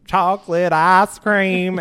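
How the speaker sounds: slow, loud twangy Chocolate ice cream; young Texan drawling his favorite dessert; heavy Texas twang. Retold or imitated. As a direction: stretch ice into a long Texas "ahs". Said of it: "it almost sounds like you're saying ice cream".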